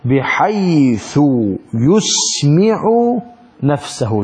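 very slow, drawn-out words; slow emphasis. Shown as a demonstration. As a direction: stretch each word to stress the rule. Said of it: "drawn-out emphasis on the rule".

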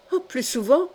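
cowardly Magloire protesting with effusion; effusive outcry. Acted. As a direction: blurt it out with feeling. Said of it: "se récria Magloire avec effusion".

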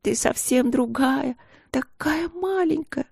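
put-on little girl sobbing into her father's side. A narrator's reading. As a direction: wail through tears like a small girl. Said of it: "начинала рыдать ещё громче".

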